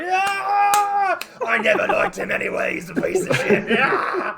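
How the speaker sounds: loud jeering crowd roar, laughing; cheering crowd. Acted. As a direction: whoop it up like a bloodthirsty crowd. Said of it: "the crowd is just like".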